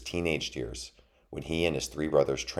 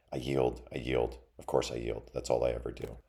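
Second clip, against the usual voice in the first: low-energy, softer defeated mouse; resigned surrender; 3 semitones lower, 6 dB quieter than usual. A narrator's reading. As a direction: give up with dejected resignation. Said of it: "The mouse sighed and let his sword".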